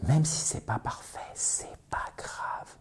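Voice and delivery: hushed, whispered aside; whispered reassurance. Real, not acted. (chuchoté, rassurant)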